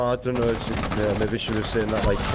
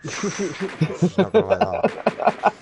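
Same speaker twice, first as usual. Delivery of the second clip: laughing; 11 semitones higher, 6 dB louder, much slower than usual. Real, not acted. Laughing reaction to the friend's teasing.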